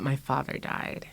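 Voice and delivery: ordinary delivery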